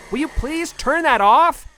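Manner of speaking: high, loud, slow plea over noise; shouting over noise. Acted. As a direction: yell over the blender.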